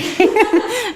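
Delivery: high bright burst of laughter. Real, not acted. Teacher laughs after teasing Juliana, a high laugh. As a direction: laugh off the joke. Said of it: "No, I'm joking".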